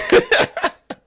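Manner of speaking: squeaky high laugh; high giggle. Real, not acted. Laughing at his kid being famous at the plant, high giggle.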